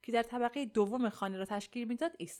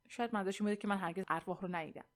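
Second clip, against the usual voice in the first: plain reading voice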